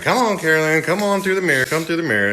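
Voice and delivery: high-pitched mock pleading summons; urgent calling. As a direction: imitate urging someone to come over. Calling the girl through mirror.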